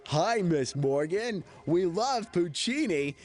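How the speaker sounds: very slow, high goofy fanboy; dopey fan voice. Retold or imitated. The mouthed-then-dubbed extra line, slow high goofy fan. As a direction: stretch it out like a dim adoring fan. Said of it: "So I'm like".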